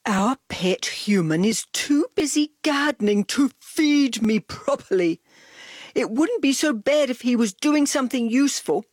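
big loud whiny dog voice; Dog's moaning voice. A narrator's reading. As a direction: moan it out as the grumpy dog. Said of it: "Dog moaned".

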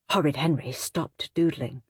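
usual speaking voice

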